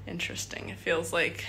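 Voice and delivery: ordinary delivery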